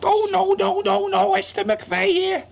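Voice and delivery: very high, slow Liverpool voice; Esther McVeigh impression. Doing Esther McVeigh for his co-host, very high mock Scouse. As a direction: squeak it out as a Scouse minister. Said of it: "I could do an impression of her".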